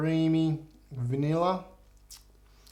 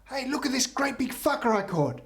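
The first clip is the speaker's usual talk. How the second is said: high-pitched acted boast; excited showing off; 10 semitones higher than usual. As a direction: call out the catch proudly, voice raised high. Priest shows off his catch.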